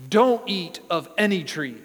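loud, forceful ban; mock stern prohibition. Preacher voicing the serpent's false version of God, loudly forbidding. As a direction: hit DON'T hard, like a strict order. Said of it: "the serpent claims God said".

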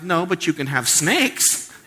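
a playful punchline from God; God's reply. Retold or imitated. Pastor voicing God answering complaining Israelites, mock stern. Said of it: "And God's like".

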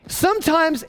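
normal talking voice

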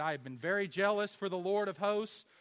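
lightly voiced prophet's complaint; Elijah complaining. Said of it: "He said".

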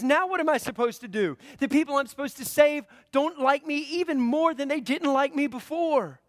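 exasperated Moses. Acted. Moses complains to God.

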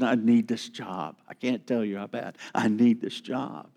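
pleading under your breath; desperate plea. Acted. A broke man pleading for the job, quietly desperate.

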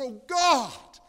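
very loud, high imitation of an anguished prayer; reenacted scream of conviction; crying out to God. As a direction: cry out at full voice as the man did.

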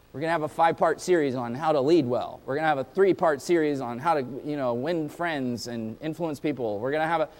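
lightly parodied pop-preaching patter; mimicked pop preacher. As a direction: rattle off sermon series in an undertone. Mimics pop preaching series announcements.

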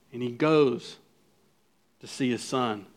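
ordinary talk

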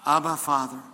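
a clear model phrase to repeat back; leading the call. Shown as a demonstration. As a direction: call it out for the room to echo. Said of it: "Say, "Abba, Father," with me".

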